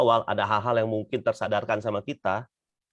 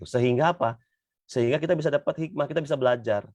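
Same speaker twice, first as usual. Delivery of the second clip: normal talking voice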